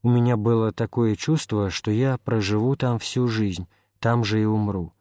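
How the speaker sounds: ordinary delivery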